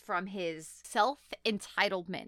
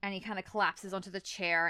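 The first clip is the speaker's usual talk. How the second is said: ordinary delivery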